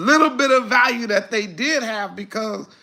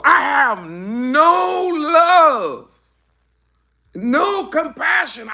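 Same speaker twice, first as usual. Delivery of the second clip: cried-out shout, high and loud; crying shout; 5 semitones higher, 4 dB louder, much slower than usual. Real, not acted. Preacher to congregation, anguished shout. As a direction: cry it out with full conviction.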